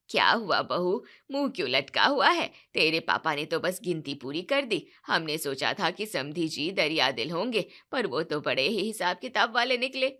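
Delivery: mother-in-law mocking the bride's father; taunting mother-in-law. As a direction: needle the new bride.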